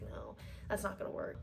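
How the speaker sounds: imitating the bouncer in a dismissive whisper; whispered brush-off. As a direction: breathe it out like a bored bouncer turning you away.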